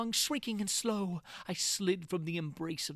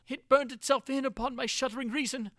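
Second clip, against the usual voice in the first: higher, with a crying catch in the voice; choked horror; 7 semitones higher, 4 dB louder than usual. A narrator's reading. Prisoner grasping the horror below, near tears. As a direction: let the words break as the truth sinks in.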